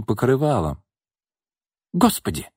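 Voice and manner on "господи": high startled shriek, higher. A narrator's reading. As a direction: squeal it in shock. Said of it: "взвизгнула Марина". (испуганный визг)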